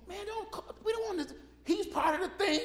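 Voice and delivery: high, fretful disciple protest; nervous disciples. Retold or imitated. Disciples warning Jesus about Matthew, high and fretful. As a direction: panic in a high voice about him. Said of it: "I can see the disciples going".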